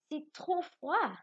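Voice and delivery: slow, high exclaimed line; exclamation. Shown as a demonstration. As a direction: say it like you feel the cold. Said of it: "c'est un sentiment qu'on exprime". (phrase exclamative lente et aiguë)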